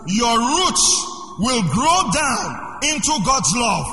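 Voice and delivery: slow, high, loud preaching read; slow proclaimed scripture reading. Acted. Preacher reading Ephesians to the congregation, slow and loud. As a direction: stretch every word out like a proclamation.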